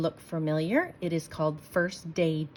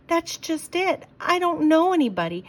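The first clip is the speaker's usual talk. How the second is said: higher, louder pleading whine; whiny child; 9 semitones higher, 5 dB louder than usual. Acted. Sarah explaining her fears to dad, whiny. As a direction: plead like an unhappy kid.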